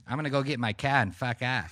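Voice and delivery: imitating a fed-up Bostonian; Boston accent. Client storming out, in a mock Boston accent. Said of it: "Perfect accent, man".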